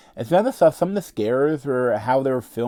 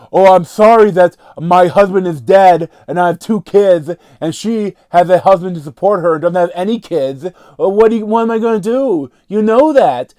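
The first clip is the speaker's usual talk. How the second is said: loud, high, hammed-up widow complaining; mocking exposition dialogue; 6 semitones higher, 9 dB louder than usual. Reviewer parodying the horror film's fake exposition, loud mock-dramatic. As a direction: overact the clunky movie lines, louder and faster at the end. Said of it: "they go in, they're like".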